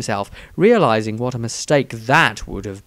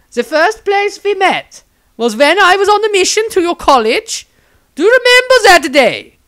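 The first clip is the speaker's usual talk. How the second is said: high, loud, syrupy nun voice; a much higher, louder fervent sister; zealous nun; 8 dB louder than usual. A narrator's reading. Nun Angelique sweetly insists to the wizard, high and loud.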